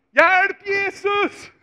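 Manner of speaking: cry for help. Acted. Peter sinking, shouting to Jesus for help. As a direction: yell for rescue while sinking. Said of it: "han ropar".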